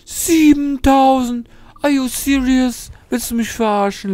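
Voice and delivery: very high, slow, loud incredulous cry; can't believe the price, stretched high; outraged disbelief. Real, not acted. Gamer outraged at the pirate's demanded price, high and slow.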